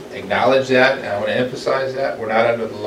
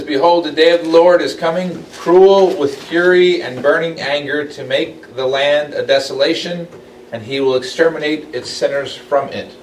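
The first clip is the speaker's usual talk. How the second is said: loud, high proclaiming of the verse; dramatic scripture reading; 6 semitones higher, 5 dB louder than usual. Acted. Teacher reading Isaiah's warning to the class, loud and proclaiming.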